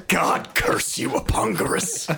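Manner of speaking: slow, breathy kingly damnation; laying a curse. Pretend. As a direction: curse him solemnly and slowly. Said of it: "I'd be like".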